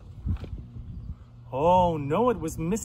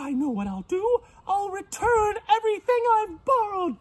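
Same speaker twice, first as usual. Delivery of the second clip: very high, loud piggy voice; dismayed pig; 5 dB louder than usual. Acted. Pig realizes he is the monster, high and flustered. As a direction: squeal in alarm, then resolve.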